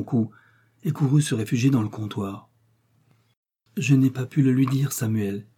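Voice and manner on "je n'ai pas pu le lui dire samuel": usual speaking voice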